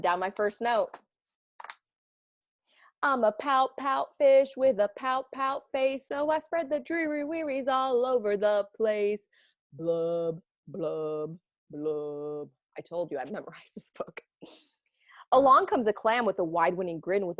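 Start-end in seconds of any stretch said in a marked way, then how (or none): 3.0-12.5 higher, slow mopey fish chant; sing-song the fish's glum refrain; fading blubs from a sulky fish; gloomy pout fish. Acted. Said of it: "the fish's refrain". 12.8-14.2 whispered, soft confiding aside. Real, not acted. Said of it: "aside to class".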